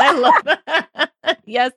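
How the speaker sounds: real laughter spilling into the words; laughing delight. Real, not acted. Host laughing at the guest's story, delighted. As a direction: laugh through the words, slow and bright.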